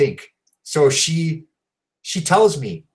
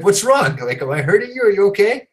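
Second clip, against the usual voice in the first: worried; 9 semitones higher, 4 dB louder than usual. Retold or imitated. Retells his worried question in bed.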